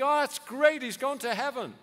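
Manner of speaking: high, loud breezy mock cheer; mock cheerfulness. Preacher mocking glib mourners, high loud fake cheer. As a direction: toss it off like nothing's wrong. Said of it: "And you think".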